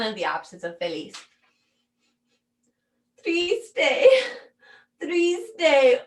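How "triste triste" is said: plaintive, high "Triste"; mock sad. Shown as a demonstration. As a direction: whine it like you're about to cry. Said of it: "I can't even say triste without tears". (triste actuado, con voz llorosa)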